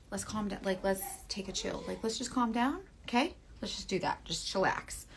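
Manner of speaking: soft, hushed settle-down voice; calming down. Acted. Telling viewers to ease off aggressive blending, calming. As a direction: talk them down quietly.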